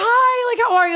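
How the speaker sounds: gushy high-pitched hi, re-enacted; excited greeting. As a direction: greet her like a starstruck fan. Retold greeting to his wife.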